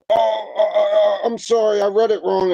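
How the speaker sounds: high, stammering mock apology from the fire chief; flustered apology. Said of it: "And now he's".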